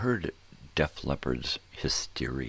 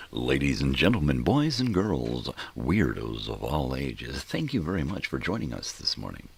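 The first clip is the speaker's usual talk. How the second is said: radio voice; 9 dB louder than usual. Pretend. Host greeting the weirdos of all ages, booming radio voice. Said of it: "I'll put on a Radio Voice".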